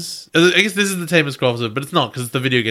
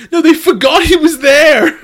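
excited; 14 semitones higher, 9 dB louder than usual. Real, not acted. Emphatic laughing correction.

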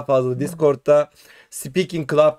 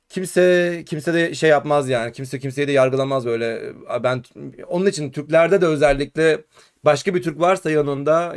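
ordinary delivery

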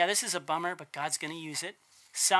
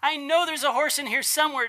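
put-on higher, louder eager kid voice; cheerful kid; 7 semitones higher, 5 dB louder than usual. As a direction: play the optimist boy thrilled in the manure. Cheerful optimist son answers dad.

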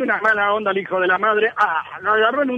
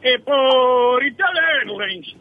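slow reply; 3 semitones higher, much slower than usual. Acted. Client answering slowly.

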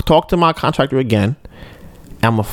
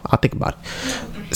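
noncommittal whisper; 13 semitones higher, 9 dB quieter than usual. Retold or imitated. Dodging wife's request.

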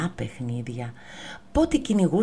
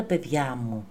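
plain reading voice

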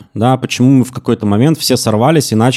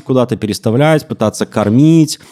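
usual speaking voice

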